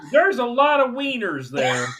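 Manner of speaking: usual speaking voice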